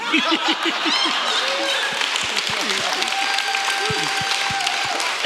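long breathless high laugh; joyful laugh. Real, not acted. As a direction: let the laugh overwhelm you.

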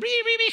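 mock modem screech, lightly sung out; playful dial-up noise impression. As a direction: imitate the old dial-up tone like a dying cat. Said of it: "it sounded like a cat was dying".

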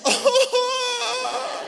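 high, loud drawn-out comic wail; exaggerated overwhelmed "oh" cry; mock wail. Dad hit by daughter's verse, hammed-up high wail.

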